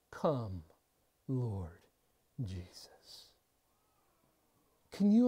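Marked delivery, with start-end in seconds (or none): 0.1-3.3 hushed prayerful plea. Retold or imitated.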